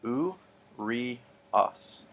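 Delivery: "U, re, os." split into three syllables with pauses. Shown as a demonstration. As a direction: break it apart: "U", "re", "os". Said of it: "this word actually has three syllables".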